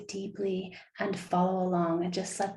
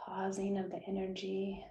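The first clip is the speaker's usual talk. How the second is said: guided meditation; 8 dB quieter than usual. Acted. Host guiding listeners through visualization, soft meditation voice. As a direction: gently lull listeners into stillness, hushed and unhurried.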